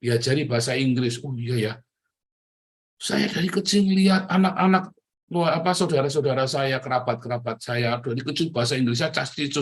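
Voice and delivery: ordinary talk, nothing marked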